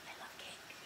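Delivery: barely voiced whisper, very soft; whispered confession. Real, not acted. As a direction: whisper it like a guilty secret.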